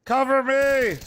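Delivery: loud urgent yell for backup; cover me shout. Real, not acted. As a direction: shout for cover mid-fight. Calling to teammates in fight.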